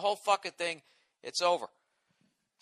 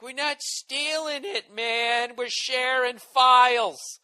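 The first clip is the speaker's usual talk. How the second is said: higher, slow mocking slacker voice; mock file-sharer; 7 semitones higher, 4 dB louder, much slower than usual. Mocking the file-sharers who claim innocence, slow and high. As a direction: drawl it out like a defensive downloader. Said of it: "And everybody's like".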